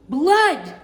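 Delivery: high, loud alarmed cry; mother's yell. A narrator's reading. Mother sees the cut finger, loud hammed-up yell. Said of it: "Loretta's mother yelled".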